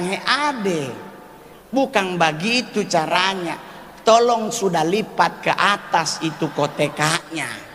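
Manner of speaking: ordinary talk, nothing marked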